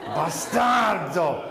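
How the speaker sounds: drawn out very slow and menacing, Bastardo; imitating his father's demonic Bastardo; Exorcist-style dad. Comedian imitating his dad cursing him, very slow. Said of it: "like he was in The Exorcist".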